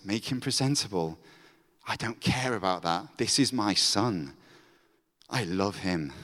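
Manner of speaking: a father holding his son close; tender father. Acted.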